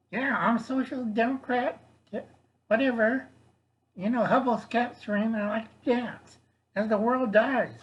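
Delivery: high puppet voice. Pretend. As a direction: speak as the little puppet. Puppet Uni talking again.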